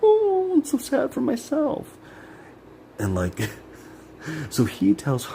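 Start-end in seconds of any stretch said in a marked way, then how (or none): 0.0-2.0 high, slow whiny mock self-pity; high, slow mock of a self-pitying mother; mocking mom. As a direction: mimic her pitying herself in a high whine. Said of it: "she would feel sad for herself".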